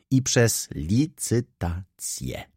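"I przez licytację" slowly, with weight on each part; slow, pointed stress on "licytację"; emphatic. A narrator's reading. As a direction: spell out "licytację" deliberately, syllable by syllable. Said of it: "dodał z naciskiem".

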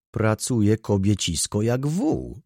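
ordinary delivery

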